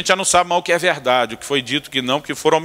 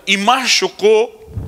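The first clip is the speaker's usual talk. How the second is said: higher, slow, sweet mother voice; mother asking; 8 semitones higher, 4 dB louder, much slower than usual. Retold or imitated. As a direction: drag it out like a fussing mom. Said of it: "a mãe faz a outra pergunta". (voz de mãe aguda e lenta)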